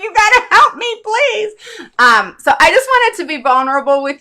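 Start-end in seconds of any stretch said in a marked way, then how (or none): 0.0-1.5 much higher, louder, pleading through a laugh; hammed-up begging while laughing; laughing plea. Calling her friend Karen for help, laughing plea. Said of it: "that's when I call Karen. Like".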